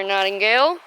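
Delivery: usual speaking voice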